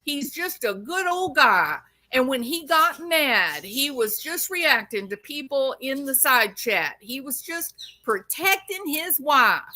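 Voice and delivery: high, fast mocking voice; mocking defenders. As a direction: sneer it out like the apologists. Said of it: "Everybody's acting like".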